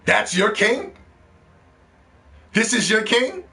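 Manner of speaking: high, rising scoffing question; mocking disbelief. Real, not acted. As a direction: scoff at the chat's pick. Mocking PlayStation as king.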